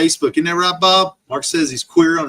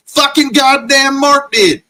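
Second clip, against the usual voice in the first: furious yell; 12 semitones higher, 8 dB louder, slower than usual. Real, not acted. Furious rant at Mark.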